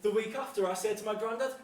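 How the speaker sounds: ordinary delivery, nothing marked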